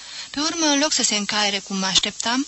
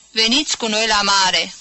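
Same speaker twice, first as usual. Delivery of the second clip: louder advertising read; poster slogan; 5 dB louder than usual. A narrator's reading. Reading second poster. (reclamă citită, mai tare)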